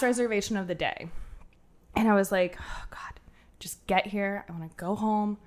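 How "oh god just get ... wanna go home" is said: whispered wish. Retold or imitated. Silently wishing last guest arrives.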